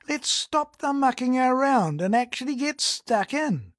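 blokey Kiwi politician stumping; rally the locals with folksy scorn; Kiwi candidate speech. Acted. Candidate haranguing voters at the mic, folksy Kiwi bluster. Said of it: "imagine a political candidate".

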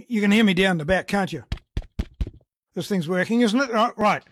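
blokey Kiwi politician stumping; rally the locals with folksy scorn; Kiwi candidate speech. Acted. Candidate haranguing voters at the mic, folksy Kiwi bluster. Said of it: "imagine a political candidate".

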